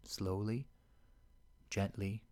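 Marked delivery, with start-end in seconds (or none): none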